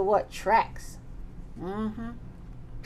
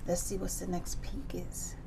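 whisper; 4 semitones lower, 11 dB quieter than usual. Real, not acted. Soft aside turning the page.

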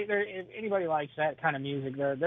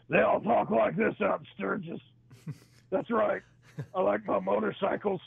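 higher, mock biker talk with a different tone; Sturgis biker voice; 6 semitones higher than usual. Shown as a demonstration. Showing how everyone at the Sturgis rally talks, mock biker voice. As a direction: do the Sturgis crowd voice, a bit silly. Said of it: "talks like this".

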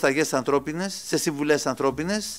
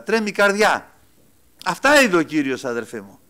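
ordinary delivery

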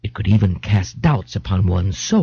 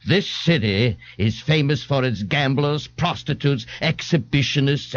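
pompous, lofty lecturing voice, pitched higher; Ignatius ranting; 4 semitones higher than usual. Acted. Ignatius lectures the policeman before the crowd, pompous and lofty. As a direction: reel off the list of sinners with grand disdain.

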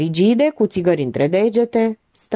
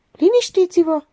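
high, firm call for calm; categorical; 12 semitones higher than usual. A narrator's reading. Alexandra intervenes in the quarrel, categorical and firm. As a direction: step in firmly, voice pitched up. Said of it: "interveni categoric Alexandra".

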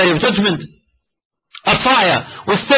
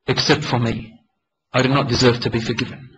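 quiet despair; 8 semitones lower, 4 dB quieter than usual. Retold or imitated. Young man's deep pain.

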